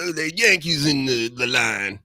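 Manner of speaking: hammed-up Texas twang; Texas accent. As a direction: imitate a Texas local heckling. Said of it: "barbecue places in Texas and be like".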